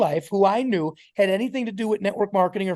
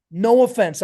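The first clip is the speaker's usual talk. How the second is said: ordinary talk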